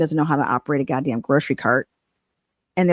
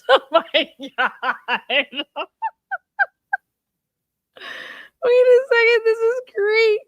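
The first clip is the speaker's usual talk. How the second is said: squealing high with laughter; cracking up. Real, not acted. Reading the joke menu aloud to viewers, squealing laughter. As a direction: laugh it out, voice shooting up.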